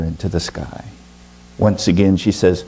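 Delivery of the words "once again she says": ordinary delivery, nothing marked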